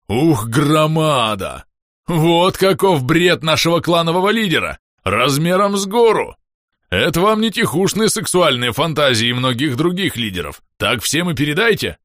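высоко и громко, хвастливо; захлёбываясь от восторга; громкий восторг. Acted. Восторженная похвальба полуорка. (громкое хвастовство)

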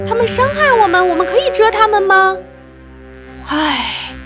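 put-on high, eager young bee; a small bee asking boldly; little bee voice. Maya voiced by narrator.

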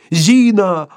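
high, loud yell; frightened yelling. A narrator's reading. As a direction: bawl her name in panic. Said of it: "орал испуганный Шариков".

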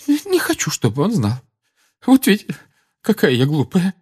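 very high tearful, stammered lament; sobbing. Acted. Mrs Weasley ashamed, still sobbing. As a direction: weep the words out. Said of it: "схлипывала миссис Уизли".

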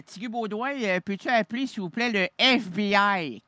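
usual speaking voice

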